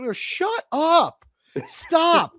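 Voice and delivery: slow, fed-up shut up; exasperated. Real, not acted. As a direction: cut the whining off with weary disgust. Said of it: "I'm so tired of this".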